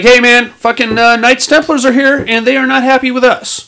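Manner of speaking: a hint of a frantic mayor calling for help; panicked mayor on phone. Mayor phoning the commissioner about Templars, mildly panicked.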